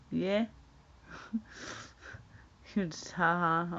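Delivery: normal talking voice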